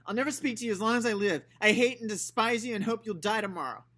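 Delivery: put-on high, loud furious child's outburst. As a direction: spit it out in a childish rage. Scout furious at Uncle Jack.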